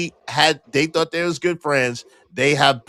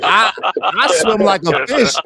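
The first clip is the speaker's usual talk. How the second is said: laughing while protesting; 4 dB louder than usual. Real, not acted. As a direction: laugh through the boast. Defends himself, laughing.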